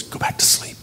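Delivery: soft whispered instruction; hushed quote. Retold or imitated. Jesus sending him back to bed, whispered. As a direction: whisper it like a bedside voice.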